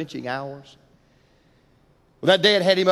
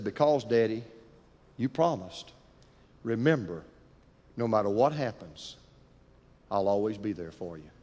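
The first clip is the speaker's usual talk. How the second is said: put-on child's soft answer; 7 semitones lower, 6 dB quieter than usual. Son softly reminding his father of the promise. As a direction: lower and softer, a tender hush. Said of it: "looked at his dad and said".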